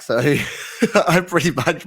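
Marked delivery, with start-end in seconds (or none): 0.2-1.0 short real laugh, louder than his talk. Real, not acted. Guest confessing to the host he records on iPhone, laughing. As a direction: laugh at your own confession.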